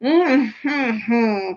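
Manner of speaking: closed-mouth hum through a full mouth; mouthful mumble. A narrator's reading. Earl trying to swallow, mumbling full-mouthed. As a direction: mumble around a mouthful of food. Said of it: "mumbled the Earl".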